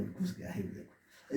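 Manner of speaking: hushed gossiping whisper. Retold or imitated. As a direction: breathe it out under your breath. Disciples gossip outside the door.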